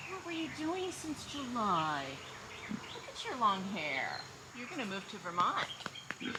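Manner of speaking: tender pig-mom cooing. Real, not acted. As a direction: murmur sweet nothings to her. Baby talk to pig.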